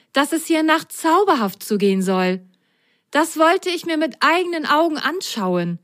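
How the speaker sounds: an adventurous sock, proud of its escape; plucky sock Milo. Acted. Voices sock character Milo.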